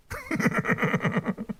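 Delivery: high, soft laughing fit; helpless giggling. Real, not acted. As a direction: crack up at your own joke. Cracks up at own joke.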